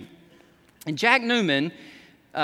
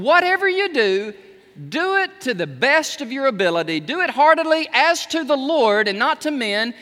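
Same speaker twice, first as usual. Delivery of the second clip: higher, louder exhortation; preaching at full force; 6 semitones higher, 3 dB louder than usual. Real, not acted. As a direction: drive the verse home to the congregation.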